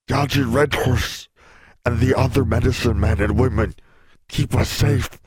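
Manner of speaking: man in pain pleads; strained, in pain. A narrator's reading. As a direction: force words through pain.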